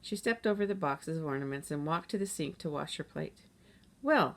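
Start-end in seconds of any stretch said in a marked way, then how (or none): 4.0-4.4 bright, louder lift on the word; a mother brightening the mood; cheerful. A narrator's reading. Mom cheerfully settling the argument for her kids. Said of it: "said Mom in a cheerful voice".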